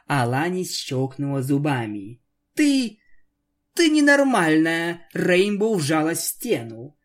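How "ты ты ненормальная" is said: high, loud frightened cry; scared outburst. Acted. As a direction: recoil and blurt it out.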